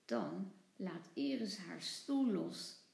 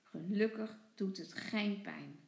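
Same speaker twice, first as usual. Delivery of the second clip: usual speaking voice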